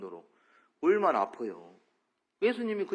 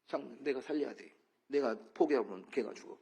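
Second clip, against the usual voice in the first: soft inner; 4 semitones lower, 8 dB quieter than usual. Acted. Jesus's inner voice voiced.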